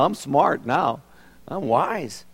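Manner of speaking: normal talking voice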